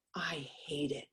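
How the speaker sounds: breathy whispered confession; whispered aside to the ladies. Real, not acted. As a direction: drop to a whisper to confide it. Confiding strong dislike to listeners.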